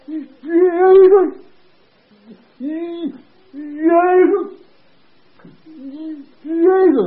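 put-on high, strained old patient's cry; Willie. Sick man answering the preacher, high imitated cry. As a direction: struggle to get the word out, high and loud. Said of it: "I heard old Willie's voice say".

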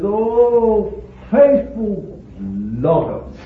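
slow, drawn-out cry of wonder; awed exclamation. Retold or imitated. Preacher acts out Paul pausing in his writing, awed. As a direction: stretch the "Oh", then slowly marvel at each word. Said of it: "he pauses and he says".

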